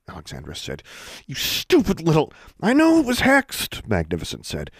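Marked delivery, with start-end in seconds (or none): 1.3-2.3 high-pitched angry insult; furious. Acted. Furious at love-potion culprit. 2.6-3.9 high, slow, loud cry; exasperated interjection. Acted. Brother cuts in, exasperated.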